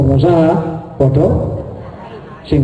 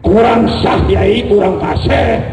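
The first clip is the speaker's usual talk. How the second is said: higher, drawn-out correction; acted reproof; 4 semitones higher, slower than usual. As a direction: fault the recitation as not fluent.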